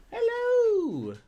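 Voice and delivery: very high cooing hello that falls away; a drawn-out, whiny falling coo to the puppy; high crying-style hello to the dog; dog greeting. Real, not acted.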